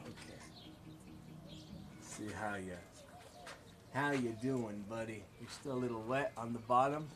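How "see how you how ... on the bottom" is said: quiet, lower murmured aside; soft chat to object. Pretend. Artist checking on a drying piece, quiet and low. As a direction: talk softly to it like a buddy. Talking to his art piece.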